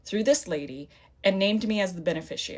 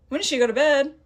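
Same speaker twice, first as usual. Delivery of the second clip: higher, louder disbelieving repeat; incredulous; 7 semitones higher, 6 dB louder than usual. Real, not acted. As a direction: echo the question in outrage. Repeats agent's question incredulously.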